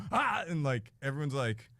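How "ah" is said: alien yell. Acted. End of Huttese outburst.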